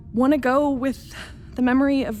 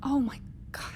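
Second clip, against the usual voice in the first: voiceless and much softer, stunned; shocked whisper; 3 semitones higher, 8 dB quieter than usual. Acted. Captain realizing she forgot her husband, aghast whisper. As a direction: breathe it out in horror.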